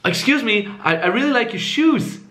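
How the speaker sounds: high, hesitant, rising on SHOES; needy, value-taking. Shown as a demonstration. Exaggerated approach to a stranger, needy and high. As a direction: seek approval with a stammered upward lilt. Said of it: "make it a little bit more extreme".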